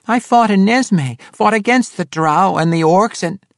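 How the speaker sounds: high, loud halfling voice; urgent protest. A narrator's reading. Halfling defends himself to the swordswoman's band, urgently protesting.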